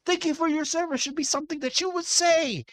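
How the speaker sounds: high, strained indignation; indignant. Real, not acted. Streamer scolding officer over veteran's treatment, indignant.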